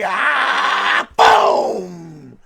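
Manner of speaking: loud shouted crash noise; cry and boom. Acted. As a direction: yell the crash as the cow slams the tree. Cow hits tree.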